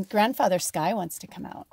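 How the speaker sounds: ordinary talk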